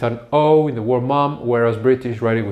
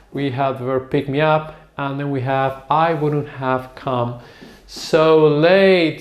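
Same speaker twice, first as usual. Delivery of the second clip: normal talking voice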